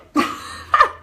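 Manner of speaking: 1 nervous squeal. Real, not acted. Horror at the thought.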